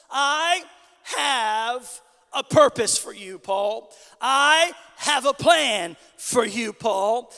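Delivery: loud, slow, spaced-out promise; the Lord's declaration. Acted. Preacher voices the Lord's promise.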